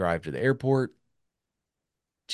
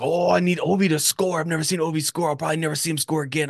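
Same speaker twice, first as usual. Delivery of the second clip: Canadian hockey fan voice; 10 semitones higher, 5 dB louder, faster than usual. Retold or imitated. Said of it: "he's like".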